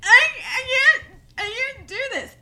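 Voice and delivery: high, teary panicked bride; mock crying bride. Bride to hairdresser, mock sobbing panic. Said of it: "crying and, like, hyperventilating".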